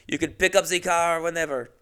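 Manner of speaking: high, put-on French voice; French accent. French boss handing over the car, mock French accent. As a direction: say it as a caricatured Frenchman. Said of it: "when you have a French accent".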